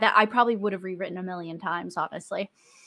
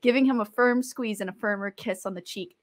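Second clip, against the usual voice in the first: ordinary delivery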